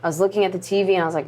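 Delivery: usual speaking voice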